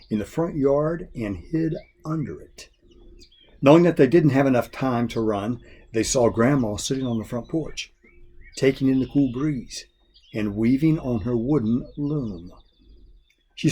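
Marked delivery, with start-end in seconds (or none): none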